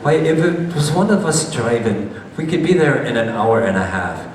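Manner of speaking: lightly imitating an old Highland local's Scottish accent. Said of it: "I like to try my Scottish accent".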